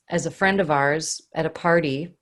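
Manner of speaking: usual speaking voice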